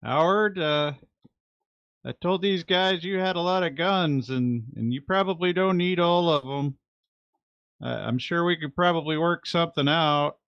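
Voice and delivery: high, carrying appeal; calling out. Acted. Shane bargaining with Howard for guns, high call. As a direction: bargain at a distance, voice pitched to carry.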